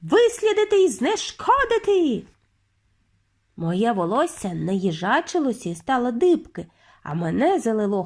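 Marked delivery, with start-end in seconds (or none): 0.0-2.2 high-pitched witch's decree; screeching. A narrator's reading. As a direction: screech orders for the hunt. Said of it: "заверещала верховна відьма".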